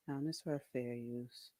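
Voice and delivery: usual speaking voice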